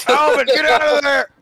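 scolding shout. Acted. Dave scolding the chipmunk.

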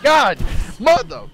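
loud frustrated shout at the game; sudden angry outburst; frustrated yell. Real, not acted. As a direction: yell it out in real annoyance. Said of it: "I can't even yell".

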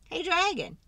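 high hail to the dragon; calling out. Acted. Reader calling out to a dragon. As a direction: call out to get the dragon's attention.

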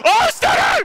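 very high shouted call; screaming wrestling commentator; excited play-by-play. Real, not acted. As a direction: erupt as the finisher hits. Calling a finishing move.

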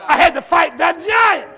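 loud, high declaration to the church; preaching shout. Real, not acted. Preacher testifying to the church about his battle, shouting. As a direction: shout it out with force.

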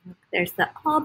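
ordinary delivery, nothing marked

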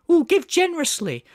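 high, put-on charity appeal; mock pleading. Mocking the critic's bid for sympathy, mock pleading.

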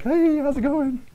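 high, slow, dainty greeting; mock delicate king Agag. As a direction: tiptoe in with a falsetto hello. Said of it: "came to him delicately".

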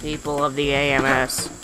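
low, soft, slow bad villain acting; deliberately awful voice acting, hushed and slow; Goldman. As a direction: imitate a stilted game villain. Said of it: "his voice is so bad".

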